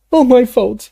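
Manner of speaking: high, loud wail of guilt; sobbing. A narrator's reading. Dumbledore blaming himself under the potion, high sobbing cry. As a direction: wail it like a sob. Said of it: "he sobbed".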